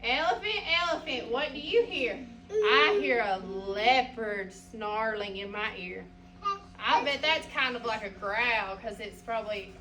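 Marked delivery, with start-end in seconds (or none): 2.5-6.0 slow, lower read-aloud voice; slow storybook reading. Acted. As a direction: read it slowly to the class. Reading picture book aloud.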